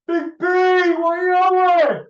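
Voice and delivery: high, loud, drawn-out call across the street; retold shout. Vlogger recalls Ben shouting as he walked by, loud high call. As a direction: bellow it like calling a mate walking past. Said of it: "I miss you walking by shouting".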